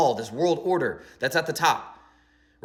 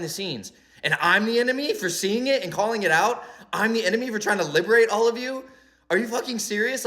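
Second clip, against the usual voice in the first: acted indignation, pitched higher; mad; 5 semitones higher than usual. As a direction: protest in disbelief at being blamed. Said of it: "mad, like".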